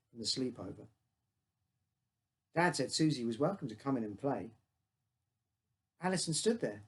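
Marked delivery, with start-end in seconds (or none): none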